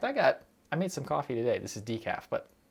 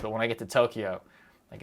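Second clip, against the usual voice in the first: ordinary delivery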